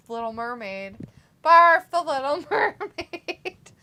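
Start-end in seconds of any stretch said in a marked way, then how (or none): 1.4-3.7 loud, high, drawn-out barf; exaggerated, laughing. Real, not acted. Mocking the Little Mermaid to viewers, exaggerated and laughing. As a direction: stretch it out slowly and break into laughter.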